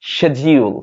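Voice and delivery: 'schedule' opening with sh; British SH start on 'schedule'; British pronunciation. Shown as a demonstration. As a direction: say 'schedule' slowly as SHED-jee-ul. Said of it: "ব্রিটিশরা এটাকে বলে".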